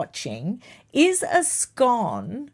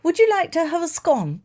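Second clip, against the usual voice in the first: a higher-pitched, lightly voiced monarch; imitating the Queen; 7 semitones higher than usual. Baker quotes the Queen offering a scone, lightly regal. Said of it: "The Queen said".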